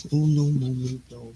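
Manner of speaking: ordinary talk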